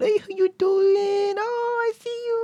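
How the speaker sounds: put-on very high, drawn-out cooing baby talk; baby talk to a niece. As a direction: coo sky-high and slow to a one-year-old. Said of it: "this baby goo goo gaga talk".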